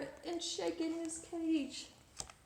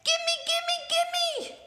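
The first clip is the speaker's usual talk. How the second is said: much higher, loud, greedy shriek; bratty princess demand; 9 dB louder, much slower than usual. Acted. Spoiled princess demanding the parrot, bratty high shout. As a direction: grab it like a spoiled child. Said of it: "Does she sound like she's being very nice?".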